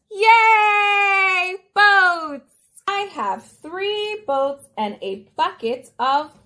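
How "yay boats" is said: high, loud, drawn-out cheer; excited cheer. Pretend. Host to toy bear about boats, excited cheering.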